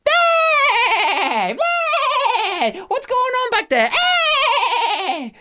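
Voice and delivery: very high, loud panicked yelps; a bully goat bitten on the rump; hammed-up shrieking goat; yelping goat.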